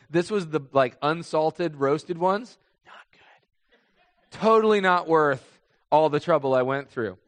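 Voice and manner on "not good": "Not good" breathed out in a laugh; laughing whisper. Real, not acted. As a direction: laugh it off under your breath. Aside on unsalted peanut.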